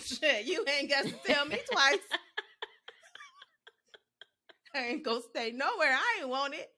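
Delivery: put-on high, loud, cheeky little-girl voice; sassy kid. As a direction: toddler talking back with attitude. Child sassy retort, retold.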